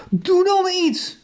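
high, drawn-out roar of urgency. A narrator's reading. Max roars from the stands for his friend to act, roaring. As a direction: cry it out slowly at a high pitch. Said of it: "brulde Max".

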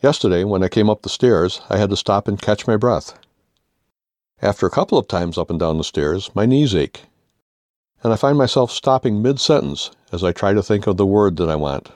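usual speaking voice

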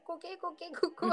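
slow, high-pitched clucking like a chicken. Retold or imitated. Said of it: "チキンクラブなのでぐっとこらえる芝居".

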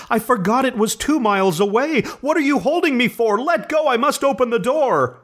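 high, desperate pleading; frantic struggling. A narrator's reading. Wife struggling against husband's grip, frantic and high. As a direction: fight free of his grip, frantic. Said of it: "she cried, struggling mechanically".

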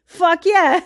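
a quick, delighted mock cheer; eager yes. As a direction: blurt it out like you can't believe your luck. Retold eager yes to invitation.

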